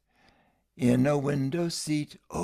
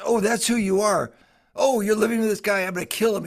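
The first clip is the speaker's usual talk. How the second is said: higher, louder indignant outburst, fairly quick; hammed-up rage at the rival, raised and loud; retold jealous threat; 6 semitones higher, 4 dB louder, faster than usual. Beggar lord hearing of the new husband, a higher, louder mock threat. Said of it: "threatening to kill".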